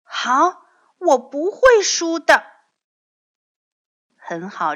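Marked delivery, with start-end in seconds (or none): 0.0-2.4 put-on high, loud child's vow; determined child. A narrator's reading. Young dinosaur accepting father's race, loud high determination. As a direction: declare it in a high kid voice.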